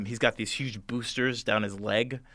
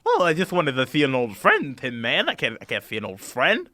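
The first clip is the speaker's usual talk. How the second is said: genial Scarecrow King; 3 semitones higher, 6 dB louder than usual. Acted. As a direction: play the Scarecrow King greeting an old friend. Voices Scarecrow King figure.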